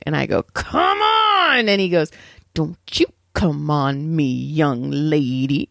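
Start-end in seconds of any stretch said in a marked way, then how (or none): 0.4-1.7 high, drawn-out cry of impatience; retold exasperated holler; exasperated outburst. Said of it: "then I pressed unmute". 2.5-5.7 imitating a slow, drawn-out scolding; slow, labored telling-off; very slow talker. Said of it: "That's how l-, l-, slow he talked".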